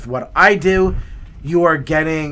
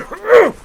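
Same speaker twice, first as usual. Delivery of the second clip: loud throat clearing; 9 dB louder than usual. Retold or imitated. Beard caught, throat clearing.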